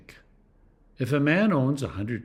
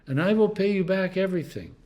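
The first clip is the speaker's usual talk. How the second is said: a touch of pleading, pitched up; begging; 6 semitones higher than usual. A narrator's reading. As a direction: read it as a modest plea. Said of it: "he begged".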